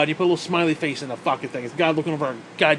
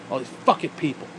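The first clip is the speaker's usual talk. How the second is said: ordinary talk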